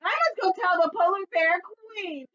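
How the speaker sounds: high, loud frantic cry; panicked. Acted. Fox panicking about melting ice, high and frantic. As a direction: blurt it in a frenzy.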